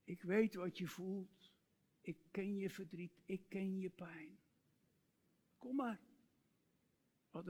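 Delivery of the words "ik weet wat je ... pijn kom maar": soft, hushed fatherly invitation, dropping to a whisper; much softer comforting father voice; tender father. Pretend. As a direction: speak as God the father welcoming a hurting child. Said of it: "Een vader die ... zegt".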